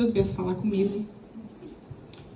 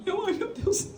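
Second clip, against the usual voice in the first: mock crying; 11 semitones higher, 5 dB quieter than usual. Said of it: "Eu lá chorando assim".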